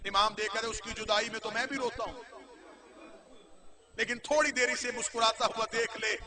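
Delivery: soft, hushed mourning narration, near tears; hushed grieving recitation. Acted. Reciter telling the mourners of the lady's grief, in a hushed grieving voice.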